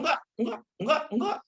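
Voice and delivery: high, insistent repeated no; stubborn denial. Acted. Denying stubbornly, acted quote.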